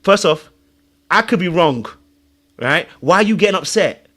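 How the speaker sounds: normal talking voice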